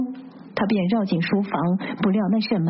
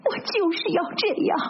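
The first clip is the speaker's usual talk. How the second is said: high-pitched defiant outburst; huffy, angry cry; 10 semitones higher than usual. A narrator's reading. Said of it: "他气 哼哼地嚷着". (气哼哼地高声嚷)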